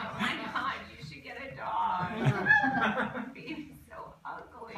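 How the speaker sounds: loud, gushing mock concern. Comedian retelling advice to a mother, loud gushing. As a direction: gush with fake sympathy. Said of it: "I was like".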